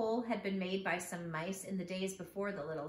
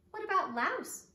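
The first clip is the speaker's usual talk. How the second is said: high, loud eager question; bright suggestion; 11 semitones higher, 5 dB louder than usual. Acted. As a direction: toss out the idea brightly. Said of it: "asked Missus Little".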